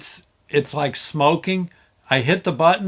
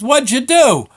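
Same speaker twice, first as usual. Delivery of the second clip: foreman yelling; 11 semitones higher, 7 dB louder than usual. Retold or imitated. Foreman shouting up.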